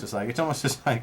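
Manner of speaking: ordinary delivery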